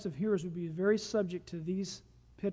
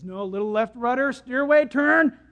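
mounting urgency; 7 dB louder than usual. Retold or imitated. God steering him away from trouble, mounting urgency. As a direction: climb to an urgent command.

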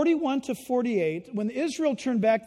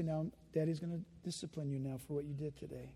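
low, soft, solemn dad; quiet father voice; 7 semitones lower, 12 dB quieter than usual. Retold or imitated. Father announcing the spanking to his child, hushed. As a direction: quietly tell the child it's coming.

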